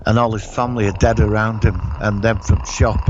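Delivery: ordinary talk, nothing marked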